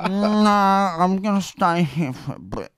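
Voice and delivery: mock drunk, half laughing; imitating drunk Ginge. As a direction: whine it like a drunk who won't move. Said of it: "And I'm like".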